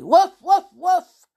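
loud high barking woof; defiant dog barks. Acted. Timmy answering the circus dogs, loud defiant barking. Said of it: "He answered them defiantly".

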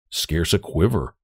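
ordinary delivery, nothing marked

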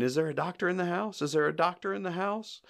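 high-pitched mock appeal, repeated; doctor calling out. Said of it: "one of the doctors keeps saying".

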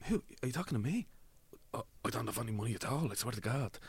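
quick, soft, pleading Dublin innocence; feigned innocence. Retold or imitated. Pretending to have no money to a mugger, mild fake fear. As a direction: play the scared victim lightly. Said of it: "I was acting".

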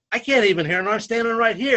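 high, loud insistence; acting out exasperated disbelief; indignant retold protest. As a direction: re-enact arguing back at the referee. Retold argument with referee.

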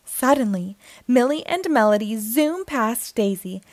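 plain reading voice

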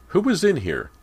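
high, loud outcry; crying out. A narrator's reading. Startled girl sitting up in bed, crying out. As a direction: demand it at a high-pitched yell. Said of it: "she cried out loud".

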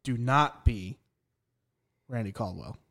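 ordinary talk

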